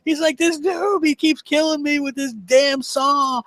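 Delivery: high, slow exasperated whine; mock aggrieved gamer complaint; imitating a complaining friend. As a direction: drag out the disbelief of dying again. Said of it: "He goes".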